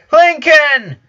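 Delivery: loud, high excited yell. A narrator's reading. Twins greeting their brother, yelling loudly. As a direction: shout his name together with joy. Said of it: "yelled both twins".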